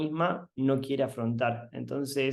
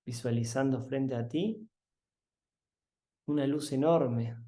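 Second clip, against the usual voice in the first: guided meditation; 5 dB quieter than usual. Acted. Guiding listeners to open their eyes, soft meditation voice. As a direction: ease them gently out of the meditation.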